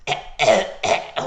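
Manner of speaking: mock choking coughs; pretend choking. Buster acts out the team choking, mock gagging. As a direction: fake choking on your own throat. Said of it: "pretends to choke himself".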